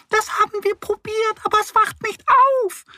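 very high squeaky mouse voices; squeaking mice. Acted. Forest mice squeak that the boar won't wake. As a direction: squeak it, fed up. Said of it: "piepsen die Mäuse".

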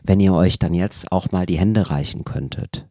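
low, soft, syrupy mediator coaxing; Mareike's mediator voice. Retold or imitated. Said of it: "Die redet auch so". (übertrieben sanfte Mediatorinnenstimme, tiefer und leiser)

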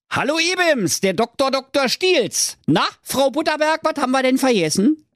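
very high squeaky villain voice, fast at the end; sly evil doctor. Acted. Evil doctor calling on Trulla, high squeaky smarm. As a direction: greet her like a smarmy cartoon imp.

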